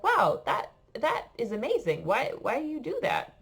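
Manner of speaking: high-pitched, wondering reaction; retold amazement. Tourist reacting to a father's yearly Nice trips, amazed. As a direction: act out being impressed and curious.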